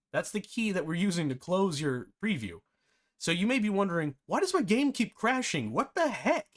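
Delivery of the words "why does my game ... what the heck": high, puzzled mock outcry; confused exclamation. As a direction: ask it like a baffled beginner. Imagined confused viewer.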